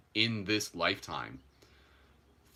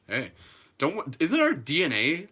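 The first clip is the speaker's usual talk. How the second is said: normal talking voice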